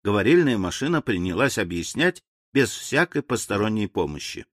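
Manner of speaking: usual speaking voice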